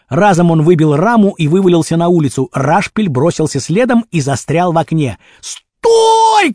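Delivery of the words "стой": very high, drawn-out yell; desperate shout. A narrator's reading. Rashpil stuck in the window calls Kurochkin, shouting. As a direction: holler after the runaway, stretching it. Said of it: "кричал он".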